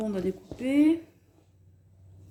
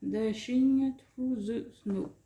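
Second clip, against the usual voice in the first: slow English read-out in a French accent; 2 semitones higher, much slower than usual. Real, not acted. Non-bilingual vlogger reading an English phrase, French accent. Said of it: "mon accent anglais, hein, désolé".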